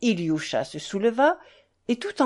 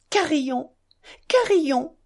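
higher, eager calling, rising; frail boy calling his dog over; sick boy calling the dog to his bed; 6 semitones higher than usual. Acted. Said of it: "cria Ilioucha".

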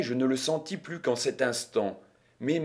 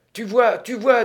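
high, loud burst of excitement; lively, radiant; 9 semitones higher, 7 dB louder than usual. A narrator's reading. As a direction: crow it in delight at the win. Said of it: "dit vivement la babouchka toute rayonnante".

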